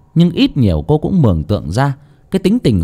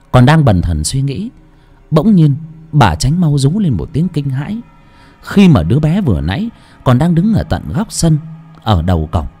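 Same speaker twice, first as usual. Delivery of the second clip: ordinary delivery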